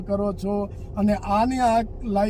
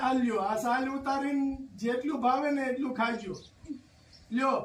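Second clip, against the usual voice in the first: gentle consoling; 3 semitones higher, 7 dB quieter than usual. Real, not acted. Visitor comforts a crying elderly woman, softly coaxing. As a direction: soothe her like calming a grandmother. Offering food to the old woman.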